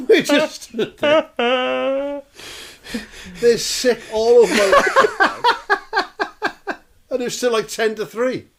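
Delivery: high-pitched, helpless laughing delivery; laughing hard. Real, not acted. Comedian summing up the afternoon disaster, laughing. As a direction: squeeze the words out through laughter. Teller laughing at his own story.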